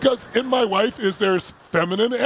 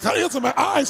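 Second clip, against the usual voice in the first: mock yelp; 7 semitones higher than usual. Husband retelling spray in his eyes, mock yelp. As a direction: cry out as if stung in the eye.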